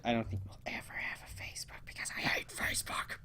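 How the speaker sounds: quick whispered grumble; whispered aside. Real, not acted. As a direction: toss it off under your breath. Muttered aside about Facebook.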